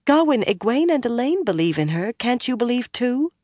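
high-pitched earnest plea; imploring. A narrator's reading. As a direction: implore him to believe, voice lifted high. Min begs Gawain to believe.

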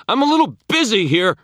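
high, loud exasperated yell; half scream. A narrator's reading. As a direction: yell it while straining. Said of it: "I half screamed".

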